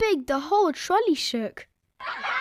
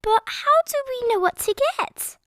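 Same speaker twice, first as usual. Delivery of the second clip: put-on high curious child voice; puzzled Peppa; 12 semitones higher, 3 dB louder than usual. A narrator's reading. Peppa asking how they choose, high and curious. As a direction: ask wide-eyed. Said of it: "asked Peppa".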